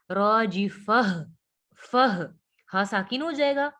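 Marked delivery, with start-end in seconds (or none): 0.1-2.3 slow रॉजिफह closing on a clearly breathed h; sounded final h. Shown as a demonstration. Teacher shows how the stop is read, sounded final h. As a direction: end फह on an audible puff of h. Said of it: "फिर क्या पढ़ेंगे".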